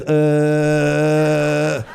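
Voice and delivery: put-on dazed, blank grunts of a kid on a phone; phone zombie kid. Comedian mimics his daughter stuck on a phone, dazed grunts. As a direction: play a child zoned out on a screen. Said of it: "they're like this".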